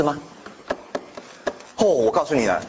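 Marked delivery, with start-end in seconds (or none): none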